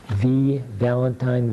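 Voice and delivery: ordinary talk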